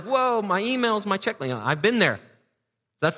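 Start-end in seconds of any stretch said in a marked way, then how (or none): 0.0-1.4 mock distracted surprise. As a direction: act out getting sidetracked, voice way up. Acting out a distracted phone user.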